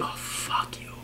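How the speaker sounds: whispered, drained contempt; near-silent whispered dismissal; disgusted mutter. Real, not acted. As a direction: breathe it out under your breath. Disgusted dismissal after mockery.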